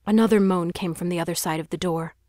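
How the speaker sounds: plain reading voice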